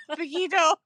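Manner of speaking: high, loud word burst out through laughter; real breathless laughing; laughing hard. Real, not acted. Friend repeating her one Spanish word, out of breath laughing. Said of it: "I'm laughing so hard".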